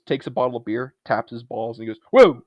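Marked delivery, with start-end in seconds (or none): 2.1-2.4 loud, high mock startle; sudden hammed-up burst; startled yell. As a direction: imitate someone jolting awake with a shout. Retold man jolting upright, startled.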